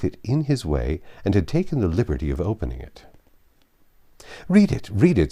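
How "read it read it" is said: higher, louder, eager and pressing; eager urging. Acted. Husband urging his wife to read Frank's letter, eager. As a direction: push the letter on her gladly. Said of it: "said he".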